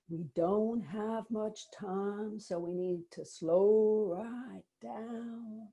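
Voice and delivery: slow right down in a borrowed accent, a self-mocking impression; Kelly Wilson accent. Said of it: "I will try and do a Kelly Wilson accent".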